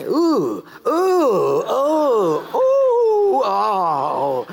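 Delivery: hammed-up onlooker's "ooh"; mock recoiling oohs. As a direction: groan in exaggerated shock at the sight, then drop. Acts onlookers recoiling at him.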